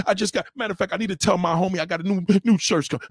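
ordinary delivery

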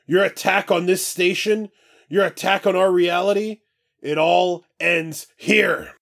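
roaring. Acted. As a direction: declare the fight over. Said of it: "Parker roars".

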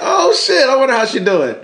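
surprised. Retold or imitated. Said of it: "And I was like".

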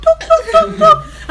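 high, quick mouth-made knocks; imitated knocking. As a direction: knock on a door with your voice. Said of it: "I get this knock".

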